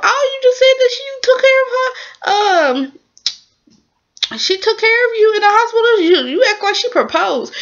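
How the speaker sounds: incredulous high-pitched comeback; mock disbelief. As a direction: scoff at him in a squeaky voice. Her own mocking reaction to him.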